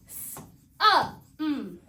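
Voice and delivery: "s, uh, mm" as three isolated sounds with gaps; sounded out. Shown as a demonstration. Teacher warning before the spelling test, "s, uh, mm" sounded out separately. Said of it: "make sure that we're not saying".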